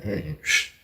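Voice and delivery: breathy shushing under the breath; whispered hush. Retold or imitated. Narrator hushing his cellmate in the cell, whispered. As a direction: hush him so the guard won't hear.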